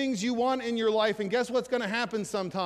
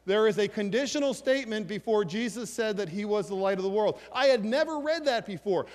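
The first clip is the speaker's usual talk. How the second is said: ordinary talk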